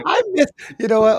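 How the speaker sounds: laughing, high and loud. Real, not acted. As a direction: burst out laughing, then talk. Cracking up at the banter.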